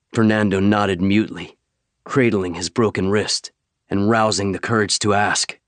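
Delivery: ordinary delivery, nothing marked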